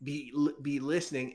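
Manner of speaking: normal talking voice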